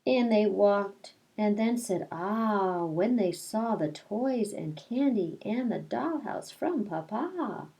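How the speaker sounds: put-on reading a picture book aloud to children; lilting read-aloud, rhyme by rhyme; storytelling voice. Teacher reading Madeline to her class, storytelling voice.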